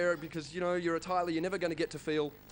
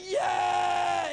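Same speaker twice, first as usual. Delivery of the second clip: mock crowd roar, high and loud; 10 semitones higher, 4 dB louder than usual. Twelve thousand fans roaring back, mock loud cheer. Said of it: "twelve thousand people go".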